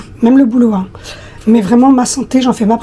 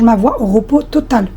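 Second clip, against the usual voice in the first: ordinary delivery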